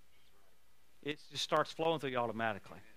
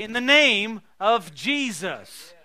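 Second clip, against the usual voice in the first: proclaimed name; 5 semitones higher, 13 dB louder, much slower than usual. Acted.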